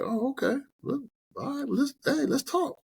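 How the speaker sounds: high, playfully smug voice; high mock-casual voice, savoring the upper hand; coy borrower. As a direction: play hard to get with the bank. You acting coy with bank.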